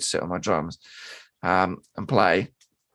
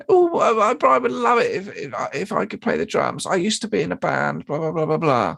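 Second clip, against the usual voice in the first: high mocking squeak, tumbling over the I's; mimicking the groom again; 7 semitones higher than usual. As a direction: parody his whiny pleading. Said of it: "Did it start with a ooh in there?".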